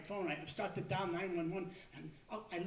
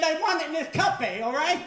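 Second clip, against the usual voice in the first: loud, higher, fed-up shout ending in a laugh; exasperated yell; 7 semitones higher, 12 dB louder than usual. Retold or imitated. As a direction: yell it like you're losing patience. Fed-up customer yells at barista.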